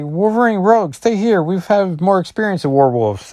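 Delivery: Brian giving orders. Acted. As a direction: bark out the command like a team leader. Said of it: "He's like".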